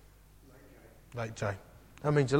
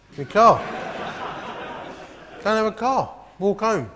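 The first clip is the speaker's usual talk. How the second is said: laughing it out, higher and louder; 8 semitones higher, 7 dB louder than usual. Real, not acted. Telling the congregation he gave away his car, laughing. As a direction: burst into laughter at your own folly.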